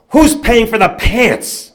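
high, loud, slow retold shout; father's angry demand. Father to yelling mother and boy, outraged loud demand. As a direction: stretch out each word in loud outrage.

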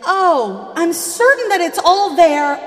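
high, bright-eyed overeager reassurance; loud, high fake cheer; mock eager cashier. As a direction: play the cashier gushing to please. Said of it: "she was like".

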